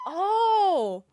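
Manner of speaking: high, loud drawn-out oh; startled realisation. Retold or imitated. Retelling what she saw on the street, high mock-startled oh. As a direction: play up the moment it dawns.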